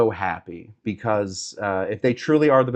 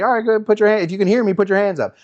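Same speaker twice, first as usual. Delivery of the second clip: camp counselor call; 8 semitones higher, 4 dB louder, much faster than usual. Shown as a demonstration. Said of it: "instead of doing, like, Quiet Coyote".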